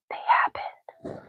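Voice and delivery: very soft conspiratorial whisper; whispered insistence. Real, not acted. Streamer insisting on false memories to chat, whispering. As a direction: whisper it as a secret aside. Repeats her claim as an aside.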